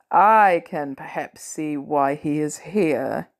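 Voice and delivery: low, slow voice of Thor; a low, slow, grudging admission; grudging concession. Acted. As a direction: concede it slowly and deep. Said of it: "Thor conceded generously".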